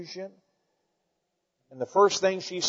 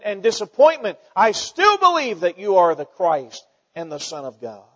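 louder, higher heartfelt plea; impassioned preaching as Martha; 3 semitones higher, 6 dB louder than usual. Acted. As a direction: pour out grief yet hold faith. Preacher voices Martha's grief.

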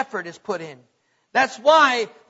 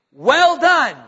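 loud praise; 2 semitones higher, 8 dB louder than usual. Acted. Master's praise quoted, louder.

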